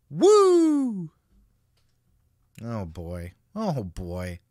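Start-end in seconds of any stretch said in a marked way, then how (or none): 0.1-1.1 high, loud drawn-out "Woo!" yell; Ric Flair woo. Retold or imitated. Reading Flair's tweet aloud, then a loud Flair-style woo. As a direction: let rip the Nature Boy woo. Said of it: "the woo always makes me cough".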